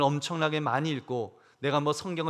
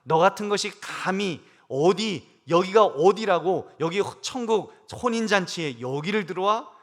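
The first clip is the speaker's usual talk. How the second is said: stern angry king; 4 semitones higher, 6 dB louder than usual. Retold or imitated. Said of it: "하나님이 뭐라고 그러실까요?".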